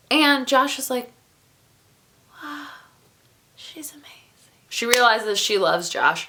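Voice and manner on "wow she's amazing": breathless whispered awe; awed whisper. Retold or imitated. Josh marveling at Lucy, whispered awe. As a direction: whisper it like you're starstruck.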